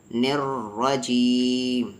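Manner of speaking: نر رجیم slowly, R thick and doubled, long final vowel; heavy R. Shown as a demonstration. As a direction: hold the heavy R in نر رجیم and stretch the ending. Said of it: "تب بھی heavy پڑھیں گے". (نر رجیم میں بھاری را، آخر کھینچ کر)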